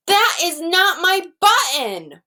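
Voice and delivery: wailing. Acted. Toad wailing at the raccoon over a wrong button. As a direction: draw it out like a whiny wail. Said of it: "wailed Toad".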